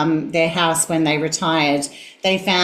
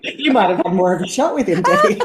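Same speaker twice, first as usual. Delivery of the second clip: playful teasing with a giggle; laughing tease; faster than usual. Real, not acted. Guest teasing host Debbie through laughter.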